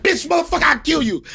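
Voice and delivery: mock fight-night yell; furious shout. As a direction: bark it like you're about to swing. Acted threat in fight.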